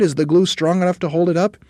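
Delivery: high, loud squeal of doubt; crying out. A narrator's reading. Monkeys to leader about glue, crying out. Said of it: "they cried".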